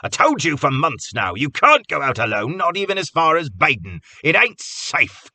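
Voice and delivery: high, loud scolding of a friend; frightened anger. A narrator's reading. Cobb scolding Carter in frightened anger. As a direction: bark it out, scared and furious. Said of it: "frightened anger".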